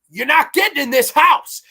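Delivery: loud, high, defiant battle yell; Cyborg shouting. Retold or imitated. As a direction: bellow it like a hero holding the door. Cyborg defending the tower.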